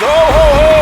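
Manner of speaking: jolly Santa bellow; Santa laugh. Acted. Host drops his Santa laugh again after asking for praise, loud and jolly.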